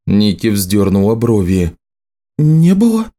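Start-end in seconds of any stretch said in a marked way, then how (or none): 2.4-3.1 high rising disbelief; surprised. Acted. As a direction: echo it back puzzled. Nicci surprised question.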